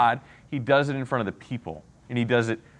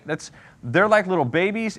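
ordinary talk, nothing marked

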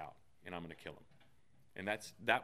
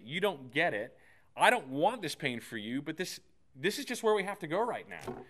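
raised, intense with feeling; emotional; 6 semitones higher, 6 dB louder than usual. Real, not acted. Pastor reliving holding his daughter down, raised and intense. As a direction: pour out the ache of a father, louder. Father recounting painful memory, emotional.

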